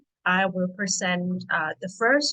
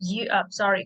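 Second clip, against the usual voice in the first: usual speaking voice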